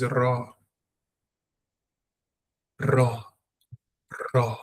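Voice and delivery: "Ra" with a trilled tongue R; "Ra" on a long rolled R. Shown as a demonstration. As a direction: vibrate the tongue starting "Ra". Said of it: "The tongue must vibrate".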